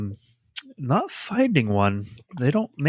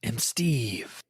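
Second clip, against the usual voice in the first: mock dramatic reveal; 3 semitones higher, much slower than usual. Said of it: "She also plays Steve".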